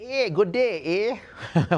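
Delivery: high, slow, hammed-up Irish accent. Auctioneer imitating an Irish-Canadian artist, mock Irish accent. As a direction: put on a jokey Irish lilt, drawn out. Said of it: "with an Irish accent".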